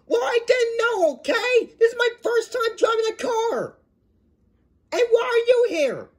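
high, upset protest; indignant, shrieky self-defence; defensive whining. Acted. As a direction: argue back in a high-pitched whine. Learner argues back.

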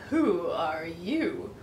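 a fox sizing up his dinner, slow and low; sly fox. Acted. Sly fox meets the dog.

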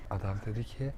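ordinary talk

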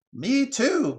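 high, loud magpie call; crying out. Acted. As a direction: cry out to join in, high-pitched. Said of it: "cried another voice".

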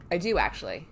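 ordinary talk

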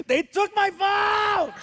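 high crying shout, slow; whiny yell. Retold or imitated. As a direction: wail it at the ground like a sulking teen. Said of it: "he yells at the ground".

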